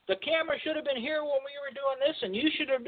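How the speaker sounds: ordinary delivery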